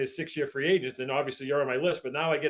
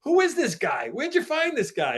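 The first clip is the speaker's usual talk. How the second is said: mock-incredulous, pitched high; imitated amazement; 10 semitones higher than usual. Guys asking the boss about an unknown newcomer, imitated amazement. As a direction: play the baffled teammate, voice leaping up. Acting out teammates' astonished questions.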